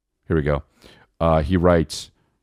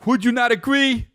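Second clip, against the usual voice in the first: concert hype; 8 semitones higher than usual. Retold or imitated. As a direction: work the crowd like on stage. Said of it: "Like he's at a concert".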